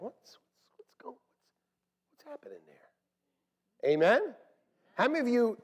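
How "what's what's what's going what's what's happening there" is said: curious whisper. Retold or imitated. Imitating newcomer's curious whisper.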